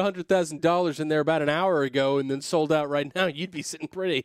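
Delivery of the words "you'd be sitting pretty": usual speaking voice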